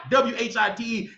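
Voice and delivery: W H I T E spelled letter by letter. Shown as a demonstration.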